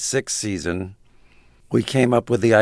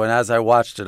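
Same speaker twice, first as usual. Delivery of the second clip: normal talking voice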